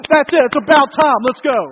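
loud, high sideline shout; fired-up sports-parent yell; parent yelling at referee. Retold or imitated. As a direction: holler it across the gym. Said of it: "when I want to yell at the referee".

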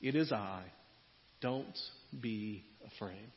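low, soft, slow comforting words; calm them like a gentle shepherd; tender reassurance. Retold or imitated. Jesus soothing frightened disciples on the boat. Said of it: "He said to them".

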